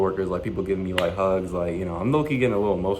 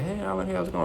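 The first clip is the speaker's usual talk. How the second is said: lightly voiced coworker, higher and soft; imitated friendly greeting; 9 semitones higher, 6 dB quieter than usual. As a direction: mimic a coworker's high-pitched hello. Voices cheerful coworker greeting him.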